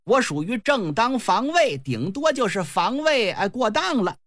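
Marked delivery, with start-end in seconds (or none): none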